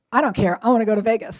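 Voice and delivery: loud, stubborn retold retort; punched-out, defiant kid's demand; insistent son. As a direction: imitate a kid digging his heels in. Son defiantly brushes off mom's objection.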